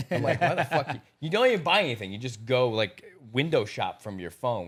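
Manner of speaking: put-on laughing, half-shouted rant at his girl; mock exasperated yell. To his girl, shopping on her phone: laughing mock yell. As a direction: throw up your hands and yell it, laughing. Yelling at girlfriend about shopping.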